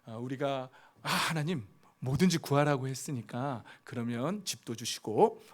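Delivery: normal talking voice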